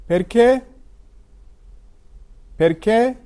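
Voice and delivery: تلفظ پرکه با تأکید روی e آخر; Italian perché. Shown as a demonstration. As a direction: model پرکه, stress on the last e. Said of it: "ا با تأکیده".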